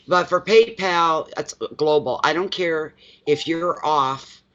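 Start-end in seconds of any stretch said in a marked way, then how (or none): none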